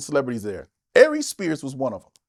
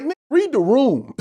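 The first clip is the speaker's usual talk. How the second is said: exasperated snap; 8 semitones higher than usual. Retold or imitated. Comic snapping at Yuck Mouth, high exasperated snap. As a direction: snap at a clueless friend to read the room. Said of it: "I'm like".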